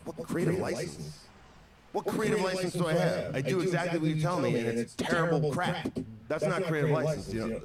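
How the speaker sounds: indignant retort, pitched well up; exasperated. Retold or imitated. Wrestler silently answering his boss about creative license, exasperated. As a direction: protest in high-pitched mock disbelief.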